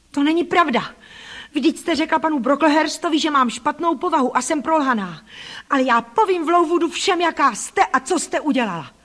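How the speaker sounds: high and loud, hurling it back; angry threat. Acted. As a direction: threaten the aunt with exposure. Child threatens to tell everyone.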